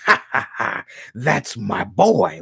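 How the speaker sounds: slow, tough cartoon bulldog voice; Spike the bulldog. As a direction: lean in like a protective cartoon dog warning a cat. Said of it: "about Spike and Tyke".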